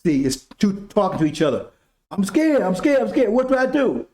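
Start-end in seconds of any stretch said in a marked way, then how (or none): 2.1-4.1 much higher, panicked pleading; the heart voiced high and fearful, asking the mind for help; frightened heart voice. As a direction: play the scared heart crying out to the mind. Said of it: "See, that's the heart".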